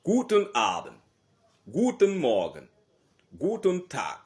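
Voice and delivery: teacher models each German greeting loudly for the class. Shown as a demonstration. German teacher drills greetings, loud and falling.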